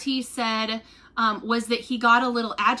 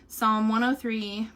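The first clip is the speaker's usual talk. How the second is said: normal talking voice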